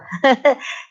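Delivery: brief amused chuckle. Real, not acted. Grandmother recalling her granddaughter's quick recovery, chuckling. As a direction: chuckle lightly at the memory.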